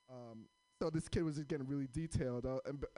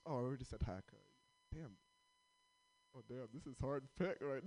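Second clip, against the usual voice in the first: ordinary talk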